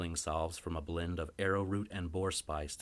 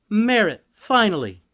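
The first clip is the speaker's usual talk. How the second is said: much higher, loud exasperated cry; exasperated wife; 15 dB louder than usual. Acted. Wife greeting her late husband, loud and exasperated. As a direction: throw up your hands at him.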